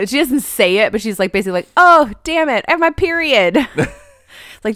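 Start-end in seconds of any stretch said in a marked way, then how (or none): 1.8-3.6 higher, louder play-acted exclamation; mock dismay. Wife inventing a reason to leave the room, mock dismay. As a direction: put on an exaggerated "oh no" excuse. Voicing a woman's pretended excuse.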